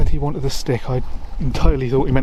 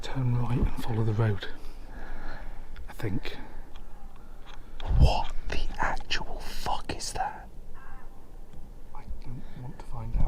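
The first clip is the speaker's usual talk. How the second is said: whispering; 11 dB quieter than usual. Real, not acted. Frightened by a noise.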